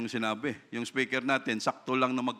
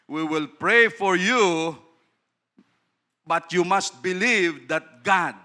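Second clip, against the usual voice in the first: solemn exhortation; 5 semitones higher, 8 dB louder, slower than usual. Retold or imitated. Pastor retells his words to sick man.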